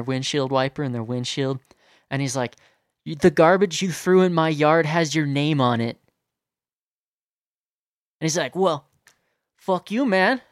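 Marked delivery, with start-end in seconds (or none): 3.0-6.0 loud, emphatic mock retort; yelling. Friend confronting the litterer over named trash, retold yelling. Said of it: "He's, like, yelling at him". 8.5-10.4 high, loud mock indignation; defiant comeback. Litterer answering the accusation, defiant.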